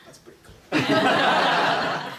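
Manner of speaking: slow, high, breathy laughing line. Retold or imitated. Surfer recalling his cool reply, slow high laugh. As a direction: stretch it out through the laughter. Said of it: "I was like".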